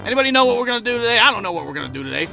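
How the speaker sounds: low, fast, rambling teacher talking to himself; muttering to himself. Retold or imitated. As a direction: mumble it fast under your breath, lost in thought. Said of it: "talk to himself".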